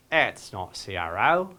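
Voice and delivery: low, drawn-out bad Australian accent; Crocodile Dundee Australian accent. Presenter jokes to the audience with a terrible Australian Crocodile Dundee accent. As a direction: ham up a slow, deep Aussie twang. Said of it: "my worst Crocodile Dundee impression".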